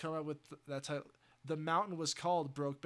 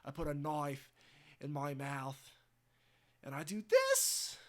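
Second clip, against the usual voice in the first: Australian Joker; 4 dB louder than usual. Acted. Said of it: "He's Australian too".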